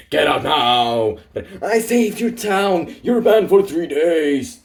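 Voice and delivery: loud, high furious shouting; angry yelling. Acted. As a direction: throw him out at full volume. Furious waiter bans the hero.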